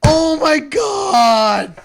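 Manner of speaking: high, loud, very slow "oh my God"; excited shout. Retold or imitated. Father and son watching Vader appear, an awed slow shout. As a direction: shout it out stretched in awe. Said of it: "me and my son were like".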